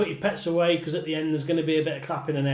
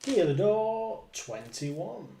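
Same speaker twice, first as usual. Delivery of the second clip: bingo caller; 3 semitones higher than usual. Acted. Calling bingo numbers with rhymes.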